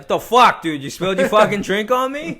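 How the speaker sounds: loud, high-pitched indignant yell; hammed-up confrontation of a drink spiller; mock Boston outrage. Bostonian to drink spiller, mock outrage. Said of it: "In Boston, you spill a drink, it's like".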